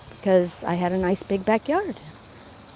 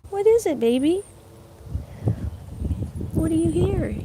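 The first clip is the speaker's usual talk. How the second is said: pet talk; 7 semitones higher than usual. Real, not acted. Talking to her dog.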